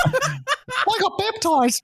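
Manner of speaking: put-on high, slow, laughing kid voice; proud little kid reply; giggly child announcing it; chirpy little girl. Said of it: "kid's answer".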